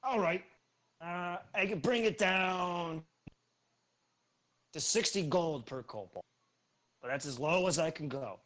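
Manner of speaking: a hobgoblin trader talking down his price; hobgoblin haggling. Acted. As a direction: hobgoblin merchant pitching up loud to close the deal.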